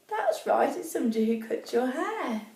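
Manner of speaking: ordinary delivery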